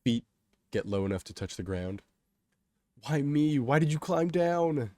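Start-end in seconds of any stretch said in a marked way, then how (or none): 3.0-4.9 loud, high complaining wail; distressed protest. A narrator's reading. Kay to Kato down on the tracks, distressed protest.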